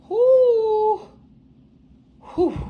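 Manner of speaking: sharp high exclamation, trailing off; exasperated whoo. Real, not acted. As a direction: blow off the frustration. Exhaling in exasperation.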